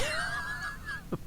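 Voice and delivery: silent, breathy, very high laugh; high wheezing laugh. Real, not acted. Laughs watching the clip.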